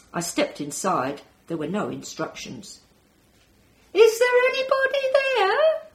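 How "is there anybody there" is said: high, loud call into the dark; yelling. Acted. Alone in the dark pod calling to anyone, loud high yell. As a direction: shout up at the ceiling, hoping someone answers. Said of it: "I yelled at the ceiling".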